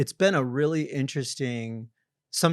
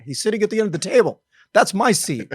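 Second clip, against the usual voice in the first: higher and louder, offended at the stolen seat; indignant outburst; 8 semitones higher, 7 dB louder than usual. Retold or imitated. Old self fuming that a new guy took his seat, indignant and loud. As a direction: huff it out like someone took your throne. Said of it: "I'd be like".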